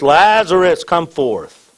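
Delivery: high, loud call on the name, then easing; loud cry. A narrator's reading. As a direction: read it as a calling-out, strongest on the name. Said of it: "he cried with a loud voice".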